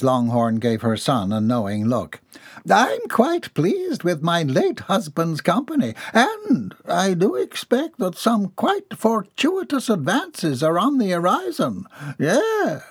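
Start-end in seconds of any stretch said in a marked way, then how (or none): 2.7-12.8 much higher, slow, haughty society matron; grand old dowager. Acted. Mrs Longhorn boasts of her late husband's company, high haughty voice. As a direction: lift the voice high and drawn out like a proud widow.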